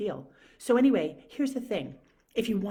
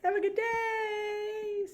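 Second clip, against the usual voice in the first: much higher, very slow, stretched out; signing off the vlog, much higher and drawn out; sing-song sign-off; much slower than usual. Real, not acted. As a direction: call out the goodbye brightly. Cheery sign-off.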